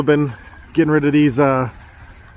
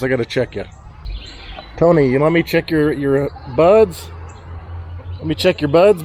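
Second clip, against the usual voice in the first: ordinary talk, nothing marked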